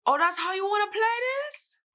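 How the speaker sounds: high-pitched mock-indignant challenge; indignant retort. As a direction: throw it out high, drawn up in mock outrage. Retold confrontation with her friend.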